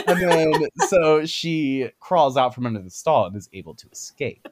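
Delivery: usual speaking voice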